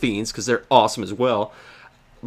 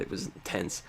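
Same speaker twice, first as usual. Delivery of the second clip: whispered; 6 semitones lower, 18 dB quieter than usual. Real, not acted. Whispered aside after tense fight.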